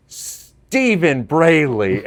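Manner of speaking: slow, high, loud full-name scold; a mother calling her son to account; scolding mom. Retold or imitated. Grandma at the window scolding her son, slow full-name call.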